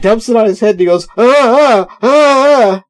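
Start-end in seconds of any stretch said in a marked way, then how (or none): 1.2-2.8 pained cries, much higher and louder; burned by the hot cheese; yelping in pain. Retold or imitated. Hot cheese dumped on head.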